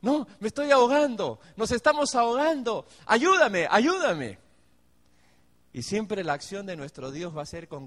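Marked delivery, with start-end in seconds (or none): 0.0-4.4 raised, louder pleading cry; desperate cry for help. Retold or imitated. Drowning believer cries out to the Lord, loud pleading. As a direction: beg like someone going under. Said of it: "lo mismo que hicieron los discípulos".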